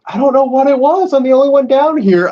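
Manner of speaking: usual speaking voice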